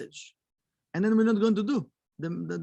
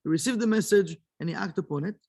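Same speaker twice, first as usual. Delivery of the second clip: ordinary delivery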